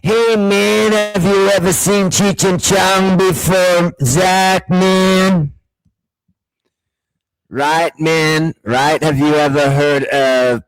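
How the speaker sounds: loud, high-pitched Californian stoner drawl; Californian accent. Acted. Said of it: "Californian accent before".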